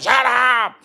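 loud, high, harsh snap; shut up. Retold or imitated. Crowd rudely silencing the beggar, loud snap. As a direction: bark it rudely. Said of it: "They would have said".

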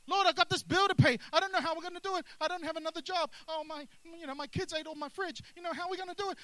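hammed-up stressed believer listing bills; mock panicked prayer. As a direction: gush worries at the Lord in a rush. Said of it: "Especially when it's an only".

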